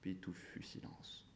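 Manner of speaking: very soft, slow near-whisper; hushed narration. A narrator's reading. Narrator after the two shots, hushed. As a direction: let it sink slowly into a hush. Silence après les coups de feu.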